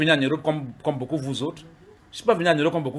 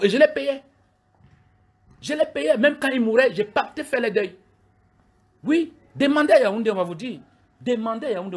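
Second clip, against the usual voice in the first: pitched up and pushed, indignant boasting; heated high rant; 8 semitones higher than usual. Real, not acted. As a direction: voice climbing high as he insists on his past. (montée aiguë et indignée)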